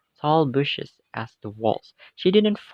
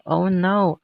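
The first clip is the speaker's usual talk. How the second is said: louder, higher "Oh no" in dismay; alarmed cry; 7 semitones higher, 7 dB louder than usual. A narrator's reading. Alice fearing she has died, crying out in alarm.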